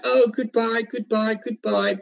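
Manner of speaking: high, gushing goodbye to the dog; excited goodbye. Retold or imitated. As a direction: coo goodbye like you're thrilled to leave. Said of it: "talking in a excited tone".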